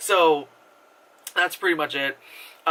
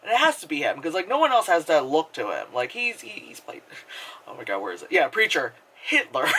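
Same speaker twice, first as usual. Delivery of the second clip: usual speaking voice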